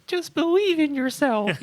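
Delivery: high, loud mocking guru voice; mock a cheesy self-help guru. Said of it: "it's just".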